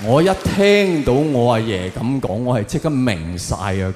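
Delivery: usual speaking voice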